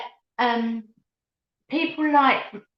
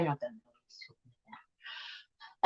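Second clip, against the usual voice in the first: much softer, quick, off to the side; muttered aside; 8 semitones lower, 16 dB quieter, much faster than usual. Real, not acted. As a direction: mutter it to yourself, amused. Aside about a teasing comment.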